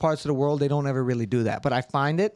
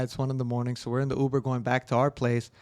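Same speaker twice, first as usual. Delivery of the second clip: usual speaking voice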